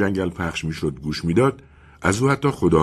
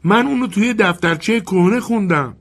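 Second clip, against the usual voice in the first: put-on much higher child's voice; childlike voice; 13 semitones higher, 3 dB louder than usual. A boy in the dark answers the wind, childlike. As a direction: speak it as a little boy. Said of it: "صدایی کودکانه".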